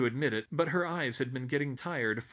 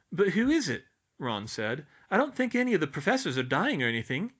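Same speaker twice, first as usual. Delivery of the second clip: higher, fast puzzled lad; doubtful boy voice; 5 semitones higher, 5 dB louder than usual. A narrator's reading. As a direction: shrug it off quickly. Ron doubtful.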